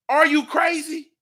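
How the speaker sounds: normal talking voice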